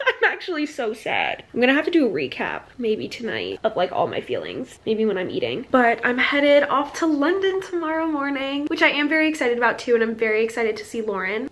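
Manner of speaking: ordinary talk, nothing marked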